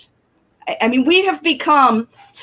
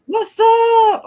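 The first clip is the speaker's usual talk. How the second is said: high, drawn-out shout back; playful echoed holler; 12 semitones higher, 4 dB louder than usual. Real, not acted. Co-host answering the host's greeting, playful holler.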